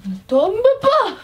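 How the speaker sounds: high, rising cry of alarm; drawn-out, high-pitched panic; alarmed plea. Real, not acted. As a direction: plead slowly as it starts to slip. Pleads with a falling curtain light.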